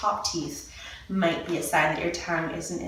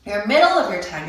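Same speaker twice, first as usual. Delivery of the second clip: usual speaking voice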